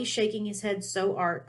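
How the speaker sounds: plain reading voice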